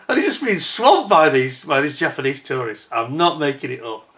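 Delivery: usual speaking voice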